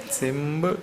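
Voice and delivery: put-on "Simple" in a South Indian accent, said as simbel; "Simple" with the p voiced to a b, South Indian style. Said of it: "I like that South Indian accent".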